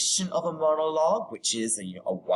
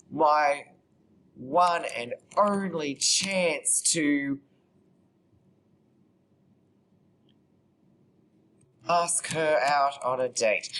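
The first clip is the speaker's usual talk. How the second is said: slow, halting dictation with long gaps; composing aloud; slower than usual. Real, not acted. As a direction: say it piece by piece as you type. Composing aloud while typing.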